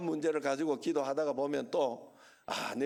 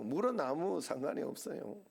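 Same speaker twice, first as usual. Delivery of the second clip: normal talking voice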